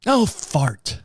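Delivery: lightly voiced wife saying oh fart; a mild mock of his wife's new word; a hint of the wife's prim exclamation; mimicking wife. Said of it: "She started saying fart".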